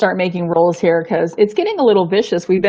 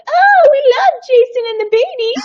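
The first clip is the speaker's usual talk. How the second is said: much higher, mocking gush; gushing fans; 3 dB louder than usual. Mocking the other players' gushing praise, much higher. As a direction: squeal it like an adoring fan, much higher. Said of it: "and they're like".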